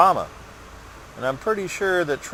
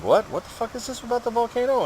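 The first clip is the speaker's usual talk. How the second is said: much higher, fast incredulous outburst; shocked disbelief; 13 semitones higher than usual. Retold or imitated. Reacting to news of the volcano, high shocked disbelief. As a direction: blurt it out in stunned disbelief. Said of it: "I'm like".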